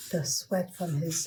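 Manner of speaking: ordinary delivery, nothing marked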